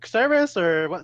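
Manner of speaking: high-pitched mock questioning; voiced sketch character. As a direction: act out a puzzled caller ringing back. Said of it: "and he's like".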